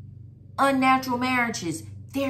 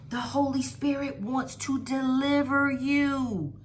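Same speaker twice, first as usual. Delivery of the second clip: normal talking voice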